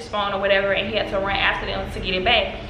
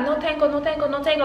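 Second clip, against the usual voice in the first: higher, pleading mock denial; imitated thief's protest; 6 semitones higher than usual. Thief denying he has it, imitated protest. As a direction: act out the suspect's repeated protest.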